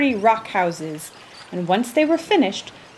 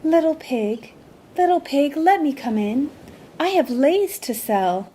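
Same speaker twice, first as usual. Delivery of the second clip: higher, sweet disguised seller voice; sweet calling; 6 semitones higher than usual. A narrator's reading. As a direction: call sweetly, pretending to be a lei seller. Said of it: "called sweetly".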